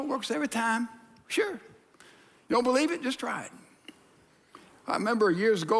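usual speaking voice

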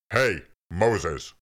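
loud call across the hill. A narrator's reading. God calls out to Moses from the burning bush, loud. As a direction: shout his name to get his attention.

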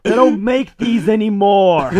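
high, loud, slow wail of protest; hammed-up anguish, every word stretched out; mock freak-out. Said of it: "he's freaking out a bit more".

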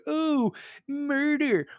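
high, drawn-out mock wail; mock dismay. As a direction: stretch it out high to ridicule them. Mocking opponents' cry of murder.

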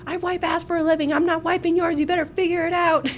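high, blunt mock mother; lightly voiced tough mom; a hint of a blunt nurse mother; mom's tough reply. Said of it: "she would say to me".

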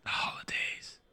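soft secretive whisper. Acted. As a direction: breathe it like a secret. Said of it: "Why are you whispering?".

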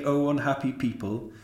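high mock prophecy wail; mocking imitation. A narrator's reading. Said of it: "it didn't sound so funny anymore".

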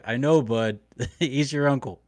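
ordinary talk, nothing marked

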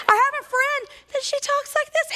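imitating a very high-pitched talker; high-pitched friend. Woman mimics how her friend talks, high-pitched. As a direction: put on a squeaky-high voice, mocking a friend's. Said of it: "people that have really high-pitched voices".

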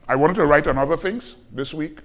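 normal talking voice

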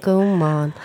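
self-pity voice. Shown as a demonstration. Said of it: "tycka synd om mig själv-röst".

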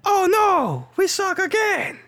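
high-pitched drawn-out movie-quote wail; Water Boy movie impression. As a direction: cry it out like a hammed-up film character. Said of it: "like, impressions as a".

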